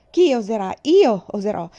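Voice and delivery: loud, frenzied battle cry; fierce chanted defiance; defiant shout. Acted. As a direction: shout it while cutting wildly. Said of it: "Tagliai e tagliai sempre più in fretta".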